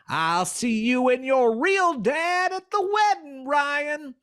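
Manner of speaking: sly fox calling out with a twang; Southern fox twang. Acted. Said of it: "twang for the voice of the fox".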